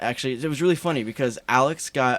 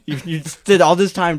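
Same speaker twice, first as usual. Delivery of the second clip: louder, higher retold outburst; mock outrage; 6 semitones higher, 8 dB louder than usual. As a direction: play his disbelief, half shouting. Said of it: "And he was like".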